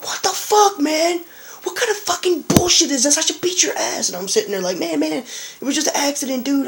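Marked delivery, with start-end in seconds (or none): 0.0-4.1 much higher, mock angry yelling; furious dealer. Dealer yelling after getting puked on. 4.8-6.7 pleading. Retold or imitated. Pleading with the angry dealer.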